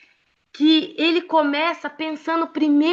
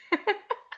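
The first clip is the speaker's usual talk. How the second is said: high giggle; 9 semitones higher, 6 dB quieter than usual. Real, not acted. Laughing at Lívia's memory.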